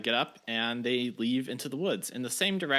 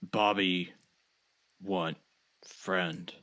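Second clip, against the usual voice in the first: lower, slow broken-English voice; simple Bobby; 6 semitones lower, much slower than usual. Acted. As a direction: speak like simple-minded Bobby. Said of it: "Bobby speaking in character".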